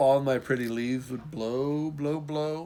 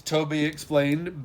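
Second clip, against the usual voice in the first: ordinary delivery, nothing marked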